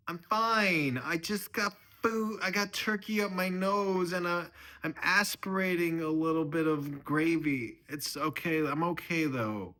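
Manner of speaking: high, loud put-on older relative; flustered mother-in-law. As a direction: insist you're all right in a high, loud voice. Choking mother-in-law reassures family.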